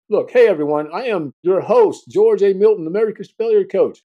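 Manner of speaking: loud, upbeat show host intro; announcer voice. Acted. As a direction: welcome listeners like a hyped host.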